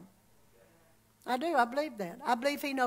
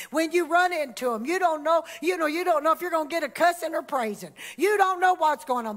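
ordinary talk, nothing marked

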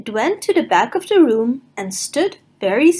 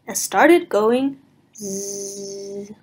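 snoring; 2 semitones lower, much slower than usual. Acted. Rock snoring.

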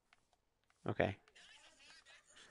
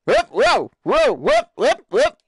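startled whoops; 12 semitones higher, 4 dB louder, slower than usual. Real, not acted. Character slipping while building.

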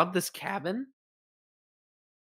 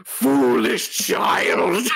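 slow, loud witch shout; scary witch voice; 5 dB louder, much slower than usual. Acted. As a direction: bellow it slowly like an angry crone. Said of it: "stop the scary voice now".

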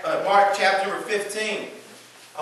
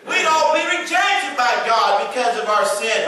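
loud, high shouted preaching; 8 semitones higher, 5 dB louder than usual. Real, not acted. Pastor to congregation on sin, shouted preaching.

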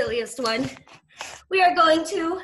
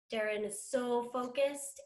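ordinary talk, nothing marked

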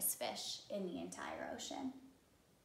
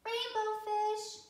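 calling out; 11 semitones higher, 4 dB louder, slower than usual. Acted. Little fish calls after him.

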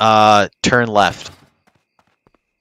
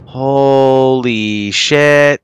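drawn-out awe; 5 semitones higher, 4 dB louder, much slower than usual. Real, not acted. Awed by crowded town.

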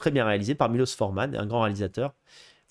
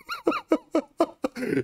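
very high, helpless giggle; giddy laugh. Real, not acted. Laughing at his own starstruck story, high giggle. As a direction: cackle in a high pitch.

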